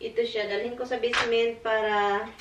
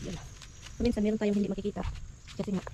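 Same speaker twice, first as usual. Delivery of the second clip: ordinary talk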